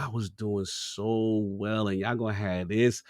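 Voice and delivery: low, soft, drawn-out mock lament; retold dismay. Gamer reacting to the new character's look, dismayed. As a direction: groan it out slowly, quietly deflated.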